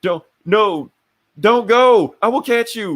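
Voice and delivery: high, loud fake protest; feigned alarm. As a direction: put on an obviously fake chase cry. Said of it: "he winks at him".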